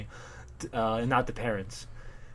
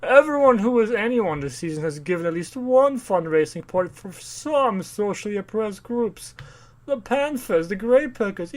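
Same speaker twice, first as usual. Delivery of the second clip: high, grandly drawn-out society voice; mock socialite husband; 8 semitones higher, slower than usual. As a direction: hold forth like a pompous rich host. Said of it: "he's like".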